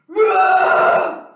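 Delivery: big shouted dinosaur roar, pitched high; loud playful dinosaur roar. Acted. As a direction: roar it out full force. Dinosaur roars at kids.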